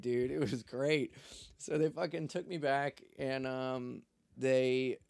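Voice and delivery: ordinary talk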